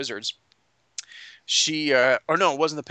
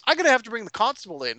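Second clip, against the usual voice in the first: quick, higher-pitched acted threat; threatening Bertram; 8 semitones higher, faster than usual. Bar owner threatening intruders with the law, indignant. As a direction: threaten them in a hurried, raised pitch. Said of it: "He goes".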